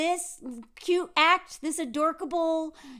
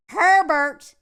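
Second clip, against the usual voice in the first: southern accent; 3 semitones higher, 8 dB louder than usual. Shown as a demonstration. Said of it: "I'm gonna use my southern accent".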